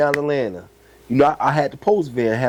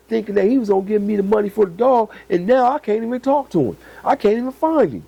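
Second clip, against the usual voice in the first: pitched-up breeder complaining about a buyer; imitating the breeder; 9 semitones higher than usual. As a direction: play the breeder venting about a buyer who vanished.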